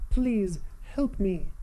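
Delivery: high, slow, pleading princess voice; Princess Zelda voice. Acted. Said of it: "my Princess Zelda voice".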